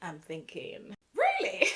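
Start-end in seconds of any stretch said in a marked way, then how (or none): 1.2-1.7 shot way up in pitch, disbelieving; incredulous. Retold or imitated. Thinking to herself about the layover, incredulous squeak.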